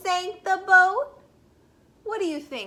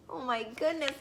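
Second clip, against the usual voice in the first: usual speaking voice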